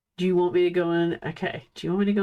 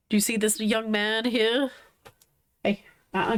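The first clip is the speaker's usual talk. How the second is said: ordinary delivery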